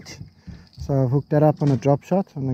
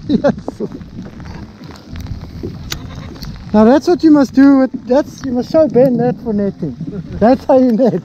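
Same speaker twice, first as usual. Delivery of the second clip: excited; 8 semitones higher than usual. Real, not acted. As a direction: crow about the perfect netting. Celebrating the catch.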